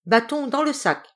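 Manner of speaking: cry. Acted. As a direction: call the stick back sharply. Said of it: "Et il cria".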